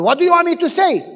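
high, quick frustrated outburst; exasperated retort. Retold or imitated. Pastor snapping back at his nagging wife, fast and high. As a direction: throw up your hands in words.

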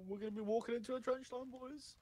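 fast, high cartoon voice; high, quick goofy impression. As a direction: rattle it off like a high-pitched cartoon goofball. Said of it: "in Goofy's voice".

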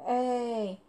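a short loud call of "Ej!". A narrator's reading. Dick calls out to Mary while crumbling earth, loud call. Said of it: "zawołał".

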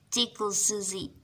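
ordinary delivery

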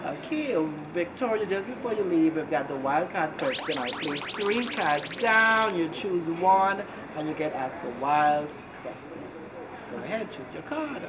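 bright, lively host announcing a game; game show host. Acted. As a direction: sell the wildcard segment like a show host.

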